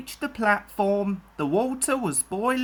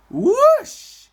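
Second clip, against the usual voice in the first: loud whoosh; 11 dB louder than usual. A narrator's reading. Sound of Henry speeding away.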